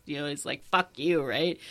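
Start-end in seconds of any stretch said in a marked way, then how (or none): none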